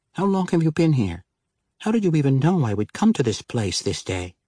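high, curious woman's voice. Acted. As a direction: ask her eagerly. Denala's voice, female role.

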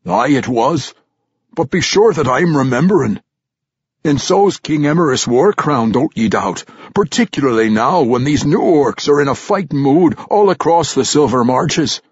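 loud, high gruff dwarf scoff; gruff old dwarf warrior. Acted. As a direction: scoff like a crusty old veteran. Old warrior Ragged Dane voiced.

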